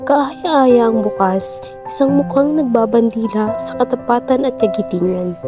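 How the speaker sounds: usual speaking voice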